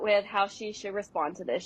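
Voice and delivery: ordinary delivery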